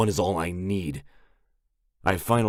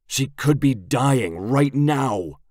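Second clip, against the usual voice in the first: desperate outburst; 7 semitones higher, 6 dB louder, slower than usual. A narrator's reading.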